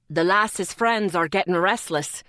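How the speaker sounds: low, loud, thick Scottish voice; burly man with a Scottish accent; deep Scottish accent. A narrator's reading. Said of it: "in a deep voice with a thick Scottish accent".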